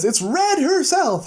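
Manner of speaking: high, slow, laughing announcement; dramatic reveal. Retold or imitated. Bigby pulls off the wig, high laughing reveal. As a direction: unveil her with a flourish.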